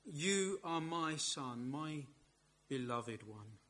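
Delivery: slow, soft tender words; the Father speaking softly to his beloved Son. Acted.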